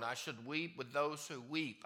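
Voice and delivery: ordinary talk, nothing marked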